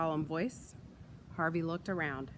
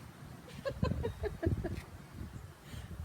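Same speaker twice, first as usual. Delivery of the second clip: high unvoiced giggle trailing off; 6 dB quieter than usual. Real, not acted. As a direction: giggle at the wind. Laughing at the windy mishap.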